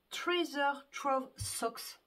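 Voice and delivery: slow, put-on British accent on the English name. Knitter apologizing to viewers, hamming up a British accent. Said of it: "Excusez pour mon accent britannique".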